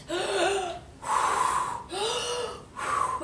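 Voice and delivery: big gasp, long blow; huffing and puffing. Acted. Wolf tries blowing the house down, exaggerated puffing. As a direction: suck in air and blow hard, repeatedly.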